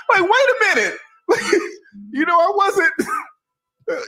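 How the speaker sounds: high, laughing wait-a-minute; laughing disbelief. Real, not acted. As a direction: break into surprised laughter.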